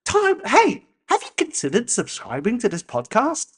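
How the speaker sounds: high-pitched peppy promo pitch; mock call to action. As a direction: hail the listeners like an overeager host. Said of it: "a call to action like".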